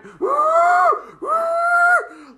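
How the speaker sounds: very high, loud drawn-out dragon cry; dragon noise. Acted. Dragon fires at laughing kids, high loud cry. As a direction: let out two big high dragon whoops. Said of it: "the dragon went".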